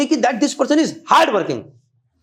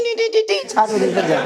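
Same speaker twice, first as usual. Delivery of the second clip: mocking singsong. Mocking a student's on-off attendance.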